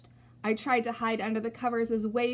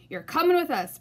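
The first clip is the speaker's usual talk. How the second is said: higher, louder gruff order; pirate captain voice; 6 semitones higher, 5 dB louder than usual. Acted. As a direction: boss the boy aboard. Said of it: "Braidbeard told me".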